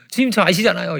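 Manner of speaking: loud mock-weeping plea to God; imitating tearful prayer. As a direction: act out someone praying through tears. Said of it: "막 울면서 막 기도를 드렸다는". (울먹이며 기도하는 흉내)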